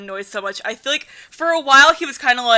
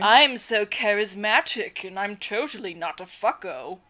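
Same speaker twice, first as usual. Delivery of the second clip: Virus; 3 semitones lower, 7 dB quieter than usual. Acted. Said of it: "he has my, like, Eric voice".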